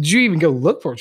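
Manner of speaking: loud, high-pitched mock questioning; incredulous husband. Husband asking his wife about the deer, imitated incredulity. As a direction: play the husband challenging her in disbelief.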